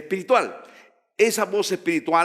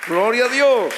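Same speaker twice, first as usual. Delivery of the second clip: high, loud cry of praise; shouted praise; 8 semitones higher, 5 dB louder than usual. Real, not acted. Preacher cries out praise to God before the church, loud.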